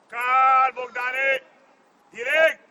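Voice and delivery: yelled commands across the pitch; full touchline yell; loud shout. Real, not acted. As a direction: shout so the player hears from far. Coach yelling instructions.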